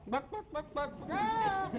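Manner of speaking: high clucking buck, buck, buck; chicken cluck imitation. Den mother clucking like a chicken as a bird riddle. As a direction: cluck like a hen for the kids. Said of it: "now watch this carefully".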